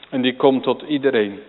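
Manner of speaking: ordinary talk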